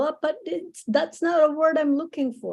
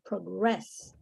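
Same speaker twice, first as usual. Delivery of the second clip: soft, low proGRESS, stressed on the last syllable; stress on second syllable; 5 semitones lower, 8 dB quieter than usual. Shown as a demonstration. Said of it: "in the second syllable".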